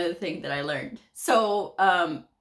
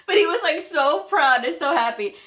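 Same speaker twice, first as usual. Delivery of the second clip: amused laughter; 10 semitones higher, 7 dB louder than usual. Real, not acted. Laughing over the doctor's pride.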